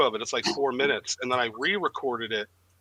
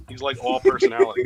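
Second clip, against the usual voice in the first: much higher, rising squeal on personality; mock gushing; 6 dB louder than usual. Teasing the guest, higher mock gushing voice. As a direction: gush over him like a fan.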